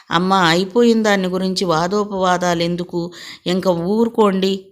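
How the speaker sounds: usual speaking voice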